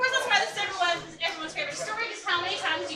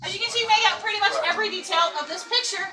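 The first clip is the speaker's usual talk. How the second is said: tour guide narration; 3 semitones higher, 6 dB louder than usual. Acted. Ghost tour guide presenting.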